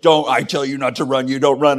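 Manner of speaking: loud, firm fatherly scolding; stern dad voice. Retold or imitated. Father scolding his son for running, loud and stern. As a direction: lay down the law like a dad. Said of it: "I use that same voice with my kids".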